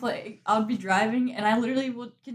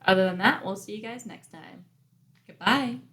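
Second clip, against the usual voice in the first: normal talking voice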